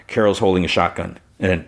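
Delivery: ordinary delivery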